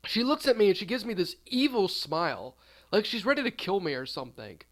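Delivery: ordinary delivery, nothing marked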